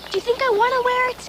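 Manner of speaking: high-pitched indignant defence; upset, pitch leaping up; hurt protest. Acted. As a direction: push back against the accusation. Defends herself in a quarrel.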